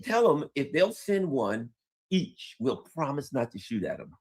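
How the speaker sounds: usual speaking voice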